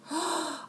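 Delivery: quick rising gasp of excitement; excited gasp. Acted. Child gasps at the teacher's news. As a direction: gasp as if a treat is coming.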